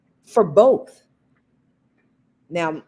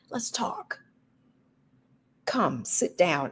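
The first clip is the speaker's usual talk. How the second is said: inviting; 2 semitones higher, 6 dB quieter, slower than usual. Shown as a demonstration. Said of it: "It should always be an invitation".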